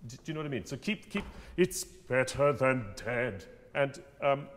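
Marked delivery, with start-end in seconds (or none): 1.6-3.4 "It's better than dead" slow and loud, every word placed; slow timed line. Shown as a demonstration. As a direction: time the line, louder and deliberate. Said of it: "You have to time that kind of thing".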